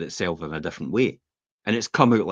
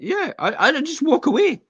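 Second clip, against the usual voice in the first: mock breezy exit; 5 dB louder than usual. Retelling walking away from a row.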